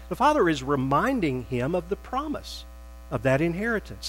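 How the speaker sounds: ordinary delivery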